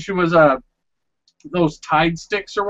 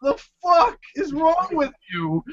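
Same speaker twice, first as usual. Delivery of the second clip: exasperated retort; 9 semitones higher, 4 dB quieter than usual. Retold or imitated. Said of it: "And I'm like".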